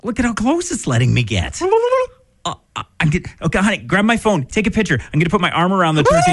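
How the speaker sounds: high, excited put-on tourist voice; hammed-up excitement, pitched high. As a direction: play a giddy tourist getting bolder. Tourist posing with wild turkey.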